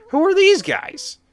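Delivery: startled at the strangers; alarmed. Real, not acted. Strange men appear in game.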